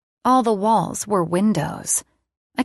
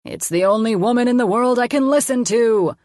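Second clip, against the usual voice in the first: raised, hammed-up villain lament; defeated play villain; 5 semitones higher, 4 dB louder than usual. A narrator's reading. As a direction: wail it as a mad scientist losing. Mad scientist defeated.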